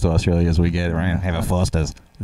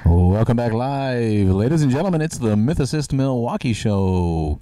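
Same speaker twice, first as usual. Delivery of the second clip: announcer voice; 4 semitones higher than usual. Acted. Host opens show announcer style.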